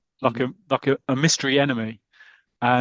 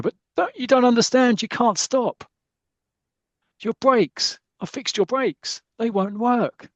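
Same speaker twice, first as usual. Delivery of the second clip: much higher, frantic confession; high-pitched terror as the car speeds downhill; desperate warning; 10 semitones higher, slower than usual. Acted. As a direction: blurt it out in rising panic. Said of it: "very dramatic".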